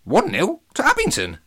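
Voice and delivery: high, loud squawking parrot. Acted. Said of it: "squawked Jabba".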